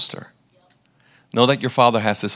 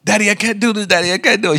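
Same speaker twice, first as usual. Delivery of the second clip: put-on panicked child; 9 semitones higher, faster than usual. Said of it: "she started to have a panic".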